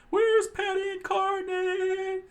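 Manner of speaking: high, stretched-out screech; hollering. A narrator's reading. Boss calls for his missing cooks, high holler. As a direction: holler it out high, loud and slow.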